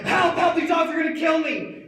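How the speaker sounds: high, loud desperate yell; panicked cry for help. Acted. Boy dragged by dogs calls to teacher, panicked yell. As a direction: yell for rescue at full force.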